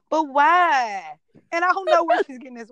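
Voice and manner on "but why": put-on whiny toddler. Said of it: "she'll be like".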